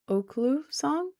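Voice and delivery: Oklu said slowly and softly, checking it; quiet, unsure Oklu; trying a pronunciation. Shown as a demonstration. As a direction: sound out Oklu with care. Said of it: "I think that's how you say it".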